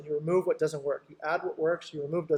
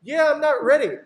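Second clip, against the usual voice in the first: raised, louder client voice; mock client; 8 semitones higher, 7 dB louder than usual. Client answering the seller with a raised mock voice. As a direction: play the doubtful client, a bit louder.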